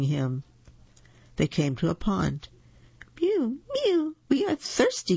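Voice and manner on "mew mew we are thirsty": high mewing kitten voice; mewing cats. A narrator's reading. Thirsty cats crying to the old man, high mewing. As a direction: mew it like tiny thirsty cats. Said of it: "cried the hundreds of cats".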